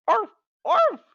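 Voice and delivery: high yappy little dog barks. Acted. Man turned into a Pomeranian, high yappy barking. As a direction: bark like a tiny Pomeranian. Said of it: "He barked".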